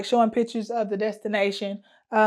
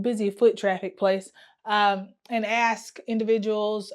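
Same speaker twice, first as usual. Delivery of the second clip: ordinary talk, nothing marked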